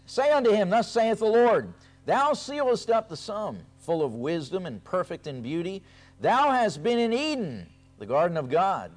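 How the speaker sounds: high, loud, declaiming the prophecy; forceful scripture reading. Acted. Preacher reading Ezekiel to his congregation, loud and high.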